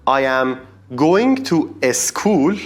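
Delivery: imitating a beginner's heavy Persian accent; Persian English accent. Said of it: "you don't want to know how my accent was".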